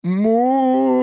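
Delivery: high loud muffled roar; gagged roar. A narrator's reading. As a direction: bellow through a gag. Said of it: "взревел воришка".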